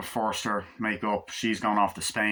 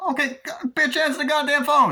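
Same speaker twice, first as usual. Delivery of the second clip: much higher, loud hammed-up tough guy; mock gangster yell; 6 dB louder than usual. Acted gangster dialogue from movie.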